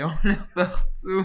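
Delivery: loud, high, laughing delivery; laughing loudly. Real, not acted. Joker feeding a crude line, laughing loudly. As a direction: burst out laughing on the words.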